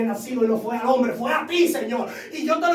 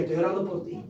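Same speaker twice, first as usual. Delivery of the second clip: gentle grandmother; 3 semitones lower than usual. Retold or imitated. Grandmother's calm reply.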